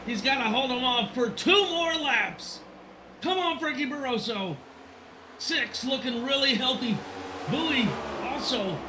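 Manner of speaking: high, excited race call; live play-by-play. Real, not acted. Announcer willing Frankie through final laps, excited play-by-play. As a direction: urge the leader on with high-pitched tension.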